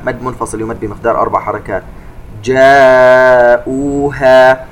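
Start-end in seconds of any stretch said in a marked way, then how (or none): 2.4-4.7 جاءوها with the aa held four beats; slow chanted جاءوها; long madd. Shown as a demonstration. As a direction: stretch جاءوها. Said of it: "كلمة جاءوها فيها مد متصل".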